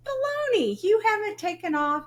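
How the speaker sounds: sharp high disbelieving "Baloney"; incredulous scoff. Retold or imitated. Mom scoffing at her son's work excuse, high and incredulous. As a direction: scoff at his excuse, pitch jumping up.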